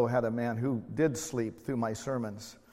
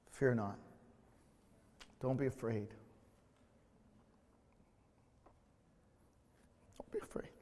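soft, fading to a whisper; Jesus softly reassuring a fearful listener; gentle reassurance; 3 semitones lower, 10 dB quieter than usual. Acted. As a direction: comfort a frightened child, almost whispered. Voice of Jesus comforting.

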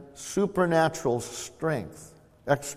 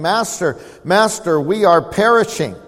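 mock panic; 4 semitones higher, 7 dB louder than usual. Disciples crying out in storm.

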